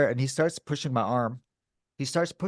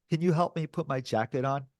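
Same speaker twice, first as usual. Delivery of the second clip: ordinary talk, nothing marked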